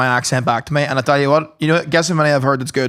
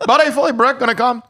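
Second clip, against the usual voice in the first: high, laughing Canadian impersonation; Canadian accent; 9 semitones higher than usual. Retold or imitated. Repeating the Canadian friend's line for laughs, high mock Canadian accent. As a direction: mimic a Canadian, voice pushed up. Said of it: "I never noticed the Canadian accent".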